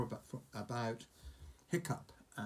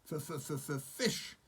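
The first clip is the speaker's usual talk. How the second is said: stuttering dragon; 4 semitones higher than usual. Acted. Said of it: "said Toothless again".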